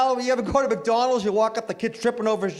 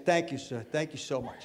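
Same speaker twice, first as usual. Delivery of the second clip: softer, grave and earnest; mock sincere thanks; 7 semitones lower, 9 dB quieter than usual. Comic shaking a first-class passenger's hand, mock solemn. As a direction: thank him like he's doomed. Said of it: "but you gotta be serious".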